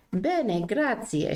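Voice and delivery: put-on light Italian accent on bene grazie. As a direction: say it like an Italian speaker. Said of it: "Do I sound Italian?".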